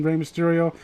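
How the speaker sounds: ordinary talk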